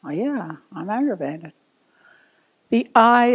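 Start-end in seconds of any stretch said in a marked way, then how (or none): 0.0-1.5 low, soft note to self; understated inward acknowledgment; acknowledging to oneself. Acted. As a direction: quietly admit it to yourself. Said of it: "you want to acknowledge that".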